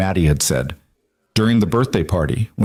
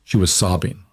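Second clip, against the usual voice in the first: ordinary delivery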